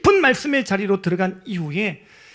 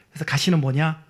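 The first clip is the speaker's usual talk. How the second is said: ordinary talk, nothing marked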